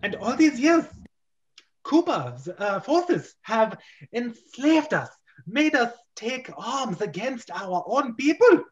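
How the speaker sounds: a hint of a playful Harley Quinn lilt; Harley Quinn style. Lieutenant telling Mario of enslavement, lightly Harley Quinn. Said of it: "sort of like a Harley Quinn thing".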